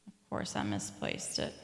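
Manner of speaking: whispered under her breath; whispered aside. Real, not acted. Searching her Bible, she whispers to herself in a whisper. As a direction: hush it to yourself while searching.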